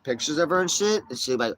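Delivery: ordinary talk, nothing marked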